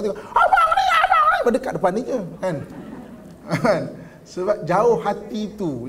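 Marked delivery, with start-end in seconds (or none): 0.2-1.4 very high-pitched squealed shout; mock angry screaming. Angry wife yelling at husband right beside her, mock screech. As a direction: screech as if the listener were far away. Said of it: "dia bercakap menjerit-jerit".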